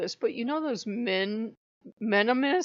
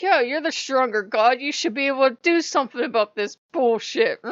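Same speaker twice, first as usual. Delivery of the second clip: raised, emphatic mock scolding; retold yelling; 4 semitones higher, 6 dB louder than usual. Speaker still yelling at Odin, acted exasperated rant. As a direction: re-enact berating a god. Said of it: "I'm yelling at him".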